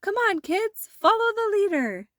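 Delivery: rally everyone for a parade; Frosty calling the kids to a parade; cheerful shout. A narrator's reading. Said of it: "shouted Frosty".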